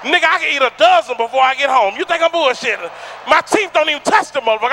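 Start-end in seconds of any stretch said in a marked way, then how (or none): none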